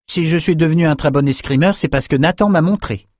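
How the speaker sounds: put-on young man boasting of a marvel; eager young Bannon. Bannon telling drinkers about the archives, eager high voice.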